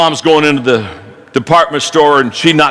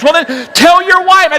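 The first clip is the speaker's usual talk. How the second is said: high retort; 11 semitones higher than usual. Acted. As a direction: snap back the obvious answer. Coworker's retort in the joke.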